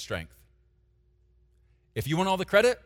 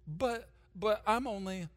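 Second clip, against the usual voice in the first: higher, softer, slow hesitant whine; self-pitying stammer; 7 semitones higher, 6 dB quieter, slower than usual. Shown as a demonstration. Pastor mimicking how people doubt themselves, softer, higher whine. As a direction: stammer like you feel too small. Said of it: "this is how a lot of us talk".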